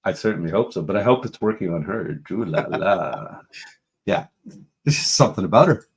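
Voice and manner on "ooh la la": low, slow, drawn-out swoon; lingering low croon; flirty ooh-la-la. Pretend. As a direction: stretch it out low, as if smitten. Repeats flirty catchphrase about her.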